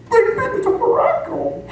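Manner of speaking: high, startled double take; incredulous inner voice. Retold or imitated. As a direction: catch the mistake mid-thought, squeaky high. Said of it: "And I'm thinking".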